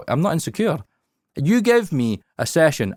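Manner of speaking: normal talking voice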